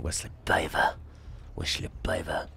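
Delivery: soft whisper twice; quiet whispered thanks to the viewer; whispered name. Real, not acted. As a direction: breathe the name out.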